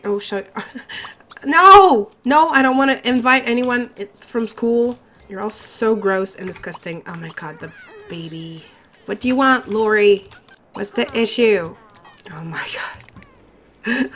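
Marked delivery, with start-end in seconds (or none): 1.4-5.0 a high, loud "No!" blurted in alarm; startled shout. Real, not acted. Player shouting at an unwanted party prompt, alarmed. 12.3-14.1 slow, breathless whisper; whispered disbelief. Real, not acted. Worn-out player whispering to herself over the crying baby.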